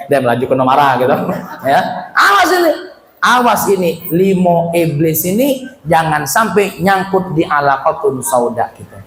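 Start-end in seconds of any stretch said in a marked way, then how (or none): none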